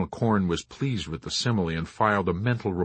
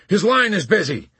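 high, loud colonel's shout; urgent yell to the general; 10 semitones higher, 6 dB louder than usual. A narrator's reading. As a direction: shout the bad news across. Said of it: "Colonel Cargill shouted".

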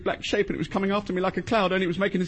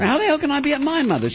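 raised, higher exasperation; indignant outburst; 8 semitones higher, 3 dB louder than usual. Acted. Husband arguing on the phone with his wife, indignant. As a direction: flare up down the phone. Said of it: "could you keep it down a bit".

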